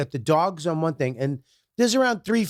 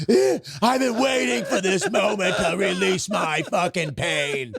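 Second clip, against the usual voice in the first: strained, high, hammed-up roar; unleashed juvenile rage; 4 semitones higher than usual. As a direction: play wounded rage finally bursting out. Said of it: "juvenile fucking abused Bobby".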